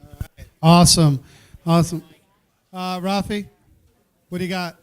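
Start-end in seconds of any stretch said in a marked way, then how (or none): 0.6-1.2 a big, loud, falling "Awesome". Real, not acted. Host calls out praise. 1.7-2.0 "Awesome" called out again, a little less loud; loud awesome repeat. Real, not acted. Host calls out praise.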